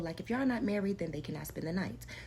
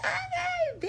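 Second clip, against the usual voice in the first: much higher, loud, slow, squealing disbelief; mock girlish delight; 9 dB louder, much slower than usual. Retelling her naive reply to him, mock squealing delight. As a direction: play her younger self as a giddy, flattered girl. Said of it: "I said".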